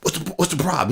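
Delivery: high-pitched imitation of an irritated cop; pissed off, retold. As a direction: mimic an annoyed officer's question. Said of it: "He's like pissed off".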